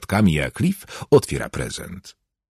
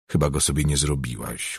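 low, subdued note of aversion; reply with restrained disgust, dropping the voice; understated revulsion, softer and lower; slight distaste; 4 semitones lower, 6 dB quieter than usual. A narrator's reading. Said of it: "odparłem z pewną odrazą".

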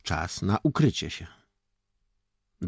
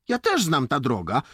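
put-on raised, higher boyish brag; boasting; 8 semitones higher, 5 dB louder than usual. A narrator's reading. Gabruk tells the lieutenant he knows the road, boasting. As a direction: show off eagerly, voice up. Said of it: "pochwalił się Gabruk".

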